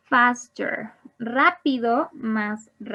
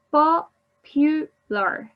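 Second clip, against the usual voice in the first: "Popular" stretched slowly and higher, each syllable clear; teacher slowly modelling "Popular" with a raised pitch; slow, spelled out; 4 semitones higher, 4 dB louder, much slower than usual. Shown as a demonstration. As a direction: draw out "Popular" so the three syllables are counted. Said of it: "Three syllables".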